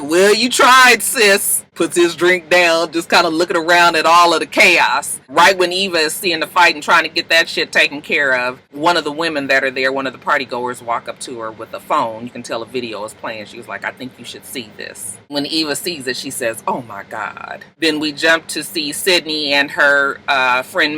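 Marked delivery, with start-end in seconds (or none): 0.0-1.6 mock consoling. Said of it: "gave Eva like". 8.7-17.6 hushed. Retold or imitated. Hushed retelling of shocking video.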